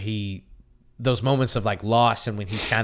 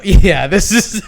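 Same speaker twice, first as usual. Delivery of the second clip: laughing; 8 semitones higher, 10 dB louder, slower than usual. Real, not acted. Laughing at failed accent.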